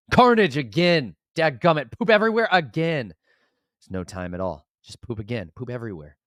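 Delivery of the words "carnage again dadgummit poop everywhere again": high, loud mock despair; exasperated outburst. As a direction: cry out at the recurring disaster. Exasperated at mess again.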